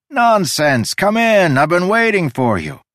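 high, loud, excited host; eager welcome. Acted. Host beckons the hesitant visitor, eagerly welcoming. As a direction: brush off the apology and urge them in.

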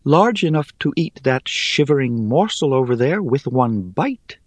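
high, airy, teasing spider; airy little voice. Acted. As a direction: toss it off lightly, amused. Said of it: "in her airy little voice".

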